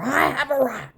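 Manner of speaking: put-on loud, high defiant old lady; imitating mom. Mother refusing to be shushed, loud high defiance. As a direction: claim it loudly and proudly. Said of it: "you wouldn't have shushed her".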